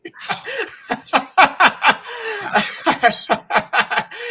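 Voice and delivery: long high burst of real laughter; big laugh. Real, not acted. Co-host cracking up after his elevator joke, laughing hard. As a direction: crack up at your own joke.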